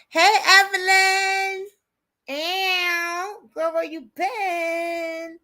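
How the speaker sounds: high, slow, drawn-out hello to a friend; excited greeting. Real, not acted. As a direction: sing out a delighted welcome. Greeting a viewer joining the stream.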